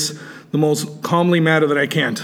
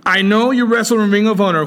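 usual speaking voice

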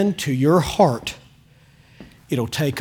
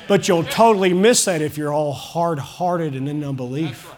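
ordinary delivery

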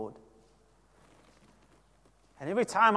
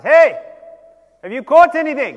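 loud, high call across the water; 11 semitones higher, 15 dB louder than usual. Acted. Jesus calls to the fishermen from the beach, loud and high. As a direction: shout to the men out in the boat.

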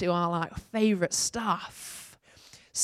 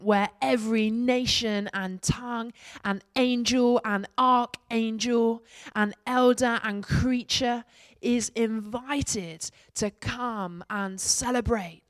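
ordinary talk

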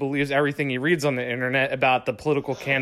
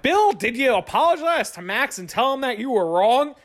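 mock scolding mother-in-law; 8 semitones higher than usual.

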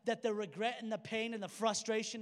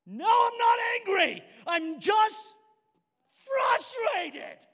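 high, full-volume acted shout of denial; angry outburst; 12 semitones higher, 14 dB louder, much slower than usual. Denying anger to his best friend, furious shout. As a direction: explode in loud, high-pitched protest.

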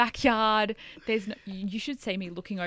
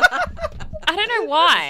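laughing; 9 semitones higher, 10 dB louder, much slower than usual. Real, not acted. Laughing over mum's accent.

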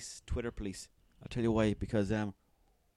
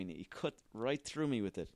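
normal talking voice

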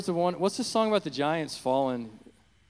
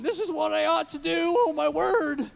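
much higher, overwrought voice of obligation; mock dutiful whine; 12 semitones higher, 4 dB louder than usual. Preacher mimics a David acting from duty, mock-fretful and high. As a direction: play the put-upon servant, pitched way up. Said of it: "Is he back there going".

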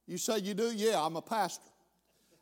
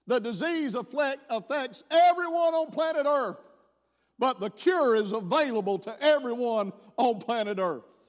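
louder, higher impassioned preaching; fiery preaching; 4 semitones higher, 6 dB louder than usual. Real, not acted. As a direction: preach it with rising force. Preaching at full force.

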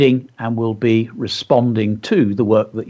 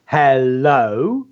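"hello" split into two drawn-out beats; two syllables. Shown as a demonstration. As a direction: stretch each syllable of "hello". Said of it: "or two syllables".

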